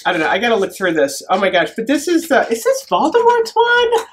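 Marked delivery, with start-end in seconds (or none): 2.5-4.1 high-pitched incredulous question; astonished. Real, not acted. Vlogger unboxing a gift spots a possible villain's wand, astonished. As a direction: gasp it out in high disbelief.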